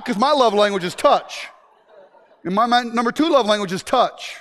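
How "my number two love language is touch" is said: usual speaking voice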